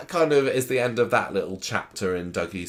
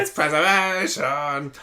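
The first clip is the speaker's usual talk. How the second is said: [name] voice; 6 semitones higher, slower than usual. Retold or imitated. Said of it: "He said it a bit in a Brummy accent".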